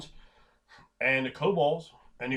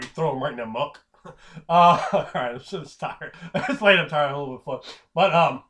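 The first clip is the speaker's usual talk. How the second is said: usual speaking voice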